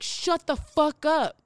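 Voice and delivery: slow, forceful retold order; angry retort. Telling him off while walking, angry. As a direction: reenact firmly telling someone off.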